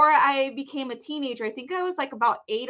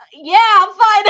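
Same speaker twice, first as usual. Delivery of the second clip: loud brush-off; 10 semitones higher, 14 dB louder than usual. Retold or imitated. Brushing off the rescuer, embarrassed.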